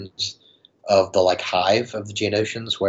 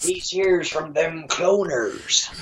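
higher, very slow mock movie-character voice; Dexter Jettster; 7 semitones higher, much slower than usual. Podcaster quoting the movie for laughs, slow character impression. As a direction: draw it out as a hammed-up impression. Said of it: "Dexter from, uh, the Star Wars".

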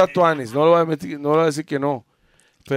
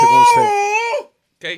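laughing outraged shout; 4 dB louder than usual. Real, not acted. Heated argument over who talks.

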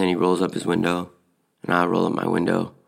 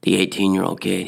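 ordinary talk

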